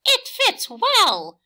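very high, loud, slow pleased farmer; Farmer Clark's voice. A narrator's reading. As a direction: announce it cheerfully as Farmer Clark. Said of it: "Farmer Clark said".

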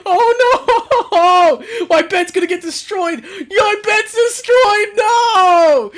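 high, loud wail with laughter in it; despairing wail. Real, not acted. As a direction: wail in half-laughing despair, drawing out the last words. His bed is being destroyed.

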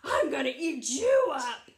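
loud, threatening troll voice; menacing troll. Acted. Mean troll to the littlest goat, loudly menacing. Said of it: "And that troll says".

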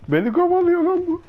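high panicked shout; yelling in alarm while fleeing; being chased. Real, not acted. Killer chasing him.

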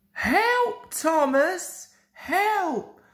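put-on very high children's voices calling for help. A narrator's reading. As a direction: play small children crying out for help. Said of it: "called the children".

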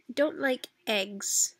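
ordinary delivery

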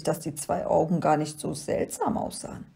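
ordinary delivery

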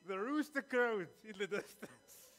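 drawn-out, loud, high rooster call; rooster crow. Pretend. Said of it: "can someone give me a little crow there?".

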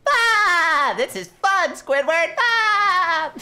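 very high, loud gleeful cartoon shrieks; SpongeBob squeal. SpongeBob shrieking happily at Squidward, very high and loud.

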